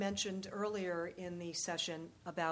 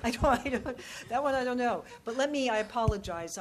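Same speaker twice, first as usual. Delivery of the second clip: laughing; 5 semitones higher, 9 dB louder, faster than usual. Real, not acted. Laughing at the Bagram question.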